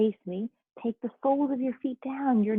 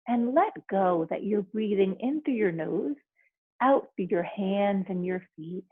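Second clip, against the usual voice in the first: right in your ear; 3 dB quieter than usual. Acted. Leads a guided relaxation.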